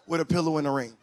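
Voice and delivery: normal talking voice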